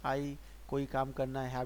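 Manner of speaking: ordinary talk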